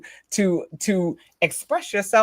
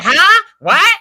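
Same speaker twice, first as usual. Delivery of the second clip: loud, much higher mock shout of a husband who can't hear; 12 semitones higher, 13 dB louder than usual. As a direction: play the husband hollering back, hammed-up.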